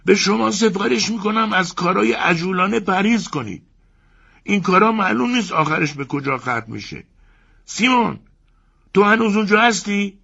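husband warning friend from inside the crocodile, high; Ivan from the crocodile. Acted. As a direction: counsel caution from the belly. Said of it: "ایوان ... گفت".

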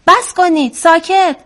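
high, loud storybook cry; acted shout. A narrator's reading. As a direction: shout to make them stop. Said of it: "که وزغ فریاد زد".

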